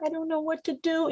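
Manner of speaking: gentle and hushed, pitch lifted; soft helpless plea. Shown as a demonstration. Coaching wives, asks husband softly for help. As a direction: soften your voice and ask for help. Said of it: "soften your voice".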